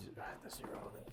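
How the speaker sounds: hushed whisper. Real, not acted. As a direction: whisper it so the room stays still. Quiet instruction during ghost hunt.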